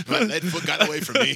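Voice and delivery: loud, high, joking protest; mock-helpless and cracking up; laughing mock excuse. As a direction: hoot the excuse through laughter. Co-host joins the joke, laughing.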